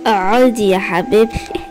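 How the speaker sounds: slow, sinister coaxing villain voice; creepy villain. Acted. Evil man luring the lost girl, slow sinister coaxing. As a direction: lure her in with false sweetness.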